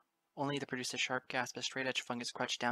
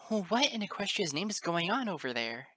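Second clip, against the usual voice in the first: high, louder shocked teacher; gasped shock; 8 semitones higher, 5 dB louder than usual. A narrator's reading. Teacher coming upon a fight, high shocked gasp. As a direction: ask it aghast in a raised voice. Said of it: "she gasped".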